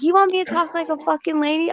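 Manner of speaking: high, fast mock-outraged question; acted-out quarrel retort, pitched high; retold indignant comeback. Acting out her angry comeback in quarrel.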